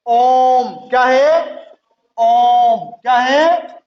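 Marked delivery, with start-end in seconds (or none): 0.1-0.9 the Om stretched extra long and loud, three times a short vowel; prolonged pluta vowel. Shown as a demonstration. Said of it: "और प्लुत जैसे". 2.2-2.9 the Om stretched extra long and loud, three times a short vowel; prolonged pluta vowel. Shown as a demonstration. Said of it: "और प्लुत जैसे".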